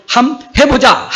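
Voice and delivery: high, loud, slow Gyeongsang-dialect rallying cry. Acted. Preacher voicing Jerusalem's people rallying, in Gyeongsang dialect. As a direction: belt it out in a Gyeongsang accent, slowly and boldly. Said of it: "경상도 사투리로".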